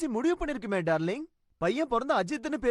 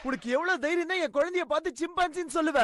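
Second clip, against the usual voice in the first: sharp shout that calms to a higher scolding; angry outburst; 3 semitones higher than usual. Acted. Father scolding the kids who called his baby a chimpanzee, angry. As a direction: yell first, then scold through it.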